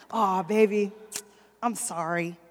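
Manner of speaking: low, soft imitated husband pleading; husband's apology voice. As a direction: play the sheepish husband begging. Husband voiced creeping into bed.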